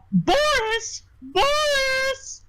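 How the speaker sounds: very high put-on woman's call; madam calling Boris. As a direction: summon your bouncer in a long, high falsetto. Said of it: "Oh my god, that voice".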